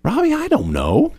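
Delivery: high, mock-helpless whine at Robbie; squeaky clueless plea to the boss. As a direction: play it like you're totally lost. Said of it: "I'm like".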